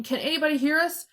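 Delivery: high, quick, desperate call; calling, voice cracking. A narrator's reading. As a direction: call out urgently for anyone. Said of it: "his voice cracking".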